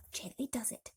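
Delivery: a soft whisper. A narrator's reading. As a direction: breathe it out gently, barely voiced. Careful descent of the peach.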